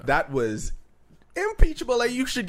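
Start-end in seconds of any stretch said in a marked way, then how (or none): 1.4-2.5 impeachable shot up high and loud; stunned emphasis; high incredulous stress. Real, not acted. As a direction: hit impeachable in disbelief. Shocked emphasis.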